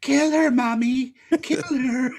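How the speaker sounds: high mock creepy whisper of a mother; Pamela Voorhees voice. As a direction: play the killer's voice in his head, high. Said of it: "went as Pamela Voorhees".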